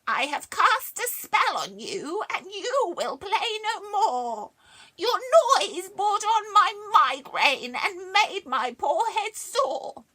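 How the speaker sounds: cackling, high, loud witch. A narrator's reading. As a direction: cast it like a gleeful witch. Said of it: "The witch said".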